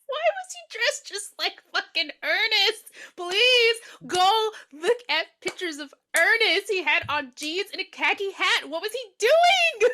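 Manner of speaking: high-pitched, exasperated comic outburst; worked-up, high-pitched disbelief; incredulous rant. Real, not acted. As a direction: rant in disbelief, building to a loud squeal. Exasperated rant about villain's Ernest outfit.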